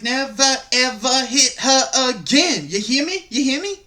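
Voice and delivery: hammering mock warning, word by word; Major Payne. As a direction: imitate a movie tough guy laying down the law. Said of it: "turn into Major Payne".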